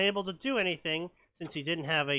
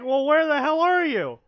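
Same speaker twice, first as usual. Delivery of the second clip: loud, high yell "where the hell are you"; shouted teammate; 12 semitones higher, 11 dB louder than usual. Retold or imitated. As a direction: yell it across the map. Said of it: "And they're like".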